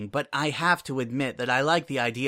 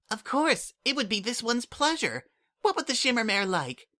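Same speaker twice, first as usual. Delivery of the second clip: put-on high, bright-eyed childlike voice; a small changeling child asking a favour, eager; Kukri, eager changeling kid; 11 semitones higher than usual. A narrator's reading. Kukri asks the Shimmermare for room service, eager and high.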